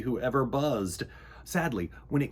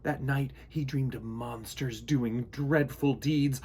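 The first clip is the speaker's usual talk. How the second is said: usual speaking voice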